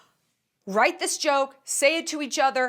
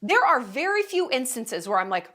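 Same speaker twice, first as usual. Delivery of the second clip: normal talking voice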